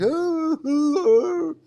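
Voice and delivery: high imitated wail; mock sobbing. Mimicking his friend's tears at the temple, high mock sobbing. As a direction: wail "ooh" in a hammed-up cry. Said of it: "and he's crying".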